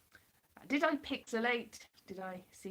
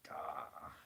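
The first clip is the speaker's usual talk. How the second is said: low-starting, halting War Doctor voice; John Hurt; 5 semitones lower than usual. Acted. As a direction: attempt the War Doctor, starting deep in the throat. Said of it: "doesn't sound anything like John Hurt".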